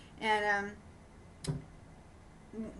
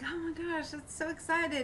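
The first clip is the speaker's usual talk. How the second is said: higher, gushing oh my gosh; mock excited; 8 semitones higher than usual. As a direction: play up the excitement for her friend. Her own mock excitement.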